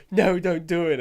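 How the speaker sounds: high, loud mock plea; pleading Lois. Podcaster imitating Lois begging Superman, loud high plea. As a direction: beg him like a movie heroine. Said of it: "She was like".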